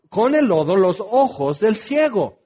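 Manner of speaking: usual speaking voice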